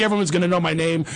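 usual speaking voice

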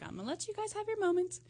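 put-on high, fast and soft girly voice; sweet girly voice. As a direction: chirp it like a polite guest. Voicing the other woman.

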